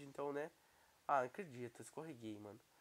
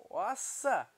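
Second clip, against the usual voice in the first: loud, higher-pitched exclamation of surprise; amazed outburst; 7 semitones higher, 10 dB louder than usual. Real, not acted. Gamer reacting to own parkour run, amazed. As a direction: blurt it out loudly, amazed.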